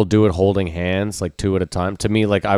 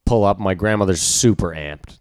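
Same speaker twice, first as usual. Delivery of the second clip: ordinary talk, nothing marked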